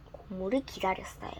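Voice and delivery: usual speaking voice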